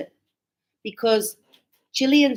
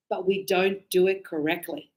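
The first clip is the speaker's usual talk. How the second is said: normal talking voice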